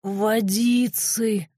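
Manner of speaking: slow, croaked plea; parched, wheezy begging; hoarse croak. A narrator's reading. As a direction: croak it out, drawing the word long. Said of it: "Прохрипел".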